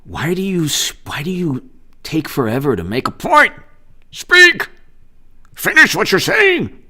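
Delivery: mock impatience rising to a high squawk; impatient heckler. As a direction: imitate fed-up listeners, jumping high on the last demands. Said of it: "people say to me".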